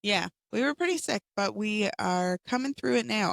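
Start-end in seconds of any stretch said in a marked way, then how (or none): none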